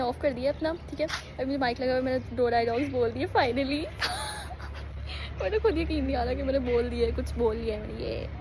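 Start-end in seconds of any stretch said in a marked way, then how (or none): 3.9-5.4 high giggle of relief; a light relieved giggle; laugh. Real, not acted. Relieved after finally speaking dialogues.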